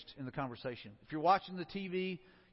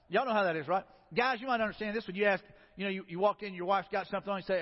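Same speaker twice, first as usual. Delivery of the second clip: normal talking voice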